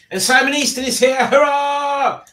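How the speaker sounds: high, loud announcement into a hurrah; cheering welcome. Pretend. Streamer hails a viewer joining the chat, cheering. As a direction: cheer the new arrival loudly.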